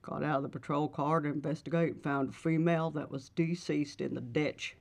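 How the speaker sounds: lightly voiced small-town Georgia police chief; a hint of a Georgia police chief giving a report. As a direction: read the chief's statement like a Georgia lawman. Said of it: "this is just a Georgia".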